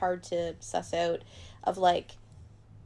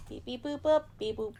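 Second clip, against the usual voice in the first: beeping and booping like a robot; playful robot beeps; toy robot voice; 3 semitones higher, 4 dB quieter than usual. Pretend. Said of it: "we could put some, uh, robot sounds".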